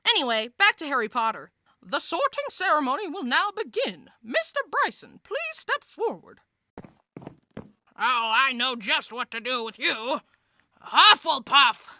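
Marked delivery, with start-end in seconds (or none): 1.8-6.4 high, formal put-on voice; ceremony announcer. Professor opening the sorting ceremony, formal announcer. 8.0-11.9 put-on character voice, ending in a loud call; Sorting Hat verdict. Hat sorting Bryson into a house, proclaiming.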